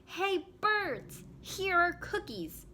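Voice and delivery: high, loud calling to birds; loud shout. A narrator's reading. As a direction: call the birds from far away. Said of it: "He shouted in a loud voice".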